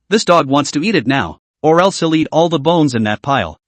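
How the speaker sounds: usual speaking voice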